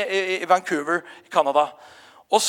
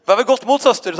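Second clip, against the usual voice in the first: mock solemn encouragement; 4 semitones higher, 6 dB louder than usual. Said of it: "sa jeg".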